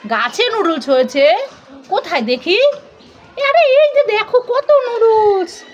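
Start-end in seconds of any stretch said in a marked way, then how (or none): 3.5-5.6 put-on high, slow showing-off kid voice; proud child. Child showing mummy noodles on the tree, high and proud.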